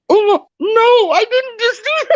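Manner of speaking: mock horror. Pig owner realising what she fed him, high mock horror. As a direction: play up the horrified realisation of a blunder. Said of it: "I'm like".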